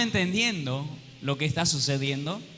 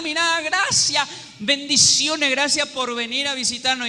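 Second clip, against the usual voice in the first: welcoming hosts; 7 semitones higher than usual. Retold or imitated. Friends greeting a guest at first, gushing thanks. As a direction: gush thanks at the visiting friend. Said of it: "Primero te van a alegrar".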